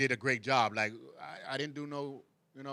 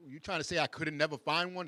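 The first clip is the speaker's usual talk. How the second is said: ordinary talk, nothing marked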